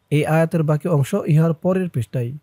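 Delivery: ordinary talk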